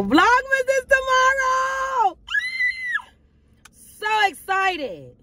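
high, slow excited shout. Real, not acted. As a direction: call it out thrilled. Announcing Vlogmas excitedly.

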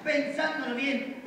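normal talking voice

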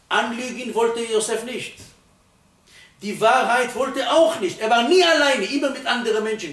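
impassioned sermon peak; fiery preaching. Acted. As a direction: drive the point home from the pulpit. Preaching at full force.

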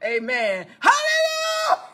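high, drawn-out shouted hallelujahs; exultant acted praise, notes held long; church praise shout. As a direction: cry it out long and high from the pulpit. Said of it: "get up there and say".

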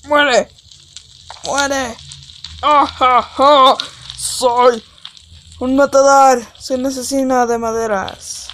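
gritos de villano en broma con risa. Pretend. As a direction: juega a ser un asesino cruel. Quemando madera, burla villana. (villano de broma quemando la madera)

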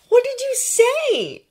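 high, slow, eager question; prodding. A narrator's reading. Draco asking Hermione about the invitation, prodding. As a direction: draw out the question teasingly. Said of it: "he prodded".

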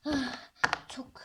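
ordinary talk

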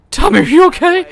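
very high, loud, mock-concerned call; hamming up worry for his character; mock worried cry. Real, not acted. Gamer calling to his crashed character, high and loud in mock concern.

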